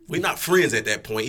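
plain reading voice

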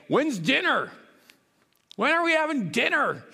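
lightly voiced whiny kids asking; mock a hungry child's nagging; impatient kids. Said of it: "they'll say".

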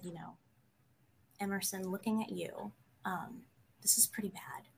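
hushed verdict. Retold or imitated. Imitating the counselor's serious warning to the student, whispered. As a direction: drop to a grave whisper.

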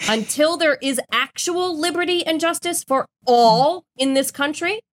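ordinary talk

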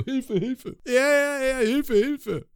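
high, slow mock cry for help; hammed-up bad actor; meme policeman. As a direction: imitate the meme cop's lame acting. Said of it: "Der macht immer so".

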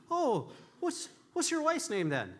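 high put-on Irish barman; bad Irish accent. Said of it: "are you ready for a bad Irish accent too".

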